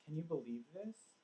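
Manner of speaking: softly bemused question, rising at the end. Retold or imitated. Father voicing his newborn daughter's look, bemused. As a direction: voice a quiet, bemused wonder. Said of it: "sort of bemused, like".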